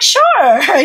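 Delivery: high, sugary mock compliance; fake agreeable. Daughter agreeing to cook for stepfather, falsely agreeable. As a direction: put on an overly willing yes. Acted compliance, privately fuming.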